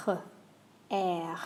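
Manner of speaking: "R" whispered from the back of the throat; a throaty French "R". Shown as a demonstration. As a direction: uvular French R, barely voiced. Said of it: "The R sound in French".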